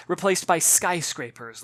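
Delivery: plain reading voice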